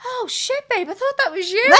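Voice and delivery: put-on high, shocked boyfriend voice; imitating stunned partner. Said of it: "He was like".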